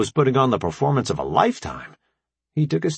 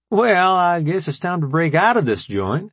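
raised, higher voice for Rambo; Rambo's line; 9 semitones higher, 4 dB louder than usual. A narrator's reading. Rambo to the team, lightly voiced. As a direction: voice Rambo a notch up.

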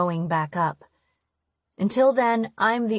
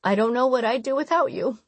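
higher-pitched, emotional confession; lightly acted tearful gratitude, pitch raised; tearful mother; 5 semitones higher than usual. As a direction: tell your child tenderly, through tears. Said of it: "wiping away the tears".

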